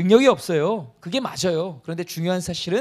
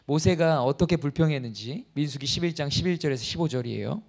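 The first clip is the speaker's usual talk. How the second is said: usual speaking voice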